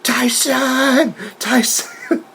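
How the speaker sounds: high, drawn-out mock scream; Jericho. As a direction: imitate a wrestler wailing for his rival. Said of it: "he's just screaming".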